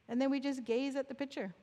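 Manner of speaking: ordinary talk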